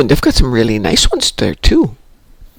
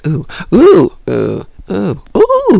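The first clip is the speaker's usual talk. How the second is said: imitating excited oohs; 6 semitones higher, much slower than usual.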